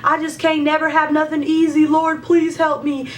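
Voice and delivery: whining a prayer at God; negative Nancy. Retold or imitated. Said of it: "a negative Nancy that day".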